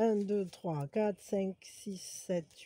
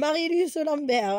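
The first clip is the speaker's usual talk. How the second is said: slow announcing; 3 semitones higher, 4 dB louder, slower than usual. Real, not acted. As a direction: draw each syllable out like a winner's name. Announcing a giveaway winner.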